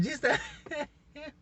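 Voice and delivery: words break up in high laughter; laughing. Real, not acted. Vlogger gushing over favorite show, laughing. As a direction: laugh through the show's name.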